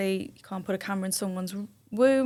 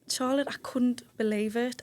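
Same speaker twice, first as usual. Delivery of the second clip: ordinary talk, nothing marked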